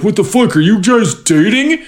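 lightly voiced astonished bystanders; mock shocked crowd. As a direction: play the stunned onlookers. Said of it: "And people are like".

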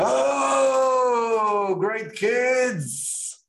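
high, put-on fake Yiddish accent. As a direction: mimic a rabbi faking a Yiddish accent. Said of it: "an accent of a Yiddish guy when he doesn't know Yiddish".

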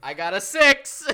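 a slow, high, loud crow of triumph with a laugh; gleeful brag. Real, not acted. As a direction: gloat over the roll. Winning the dice roll.

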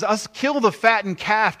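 high, loud, eager announcement; excited father. Acted. Father orders feast for returned son, excitedly. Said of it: "That's how excited he is".

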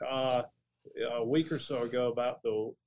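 ordinary talk, nothing marked

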